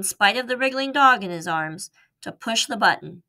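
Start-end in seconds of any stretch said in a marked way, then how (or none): none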